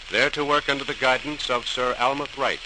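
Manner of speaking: ordinary delivery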